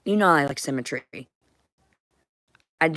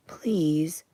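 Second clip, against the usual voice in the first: breathy pleading whisper; whispered plea. Real, not acted. Asking the voices for rest, a whispered please.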